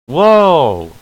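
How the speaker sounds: loud, high burst of surprise; startled shout. Acted. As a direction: shout it out in shock. Reacting to a shock in game.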